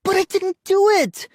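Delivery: very high screamed protest; screaming. A narrator's reading. Brother denying blame to parents, screaming. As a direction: scream your innocence. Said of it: "Ian screamed".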